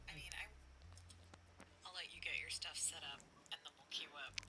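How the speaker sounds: faint, distant echo over call; call dropping out. Real, not acted. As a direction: voice leaking back through headset. Own voice echoed back over call.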